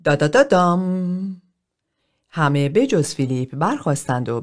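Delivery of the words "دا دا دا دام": slow drawn-out triumphant da da da dam, arms wide; loud fanfare cry. Acted. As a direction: announce her own entrance like a fanfare. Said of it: "با صدای بلند فریاد زد". (اعلام شاد و کشیده مثل شیپور ورود)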